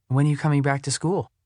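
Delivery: put-on lifted, eager young girl. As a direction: ask it brightly as the girl. Cammy asks eagerly.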